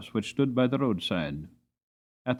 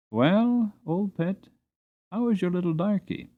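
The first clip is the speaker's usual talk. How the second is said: put-on higher, fond voice of the kind old master; kind master greeting; 7 semitones higher than usual. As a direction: greet the old mare fondly, pitched up.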